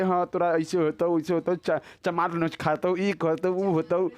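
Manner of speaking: ordinary talk, nothing marked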